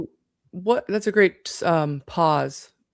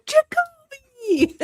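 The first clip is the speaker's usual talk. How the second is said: high, stretched name call with a laugh; mock outcry; much slower than usual. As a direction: shriek the name, drawn out and laughing. Host mimics her shocked outcry.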